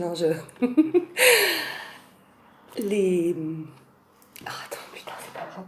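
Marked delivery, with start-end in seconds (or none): 4.4-5.6 hushed muttered whisper. Real, not acted. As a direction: breathe it under your breath. Muttering aside off camera.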